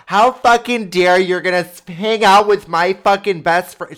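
loud, high, furious imitated yell; full-voiced retold screaming tirade; screaming girlfriend. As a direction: imitate an enraged girlfriend yelling down the hall. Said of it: "Um, screaming".

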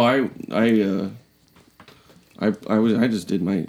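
ordinary talk

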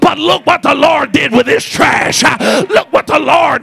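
loud, driving preaching with huffed uh breaths; full-force preaching. Acted. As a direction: shout it to the back pew.

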